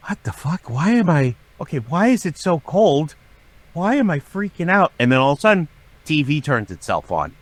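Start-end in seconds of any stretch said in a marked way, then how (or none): 0.1-4.9 high-pitched replay of rising panic; retold panic. Man recalling a near panic attack in a cold hotel room, high mock panic. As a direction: act out a mild inner freak-out. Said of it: "five seconds away from having a panic attack".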